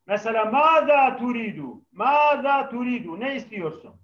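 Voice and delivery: usual speaking voice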